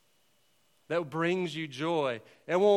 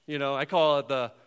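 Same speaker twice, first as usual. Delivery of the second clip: ordinary delivery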